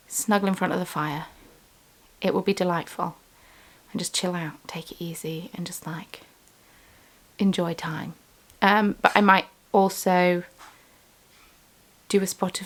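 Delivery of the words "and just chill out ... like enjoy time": dreamy softening whisper; soft and relaxed. Real, not acted. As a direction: let it melt into calm. Describing relaxing evening.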